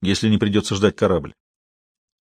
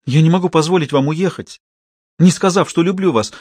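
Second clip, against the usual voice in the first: impassioned; 5 semitones higher than usual. A narrator's reading. Insistent confession.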